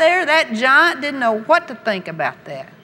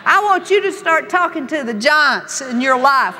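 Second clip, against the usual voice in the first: ordinary talk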